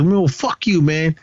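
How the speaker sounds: mock-angry, pitched well up; retold angry comeback. Arturo cursing out Emil in class, mock angry.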